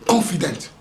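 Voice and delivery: ordinary delivery, nothing marked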